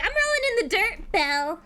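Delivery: gleeful high chatter; hammed-up high tomcat; goofy cat. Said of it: "He's like".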